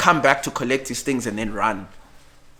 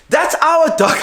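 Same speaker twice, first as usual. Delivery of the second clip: put-on high, loud cry from the girlfriend; much higher, louder outburst; upset girlfriend; 8 dB louder than usual. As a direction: she leaps out demanding her dog. Girlfriend voiced, upset and certain.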